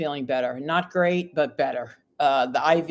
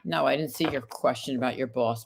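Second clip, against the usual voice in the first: usual speaking voice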